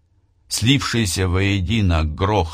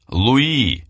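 calling out; 6 semitones higher than usual. A narrator's reading. Calling out in the dark.

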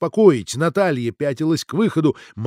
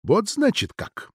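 higher, slow, hostile remark; hostile realization; 8 semitones higher, slower than usual. A narrator's reading. Natalia stares at Ksenia with dislike, hostile. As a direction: draw it out with dislike. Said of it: "неприязненно уставилась на неё Наталья".

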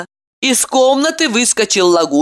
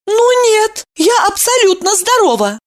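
indignant protest; 14 semitones higher than usual. A narrator's reading. Evdokia protesting.

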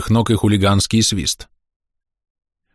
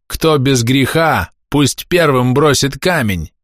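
slow, high declaimed challenge; calling out; 6 semitones higher, slower than usual. A narrator's reading. Pavel quotes scripture at his attackers, shouted challenge. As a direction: call the saying out slowly, word by word. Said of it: "крикнул Павел".

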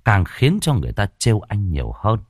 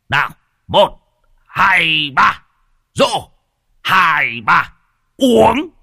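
put-on old man roaring a toast, much higher and louder; rally the young ones to drink, shouting the count; fierce drinking toast; 10 semitones higher, 4 dB louder than usual. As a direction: drunk old uncle bellowing out a toast count at the memorial feast. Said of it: "cũng hung hăng".

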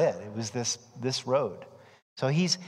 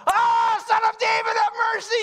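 shouting plea; 5 dB louder than usual. Retold or imitated. Blind men shouting.

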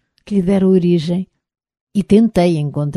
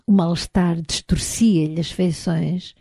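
usual speaking voice

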